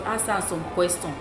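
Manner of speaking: ordinary talk, nothing marked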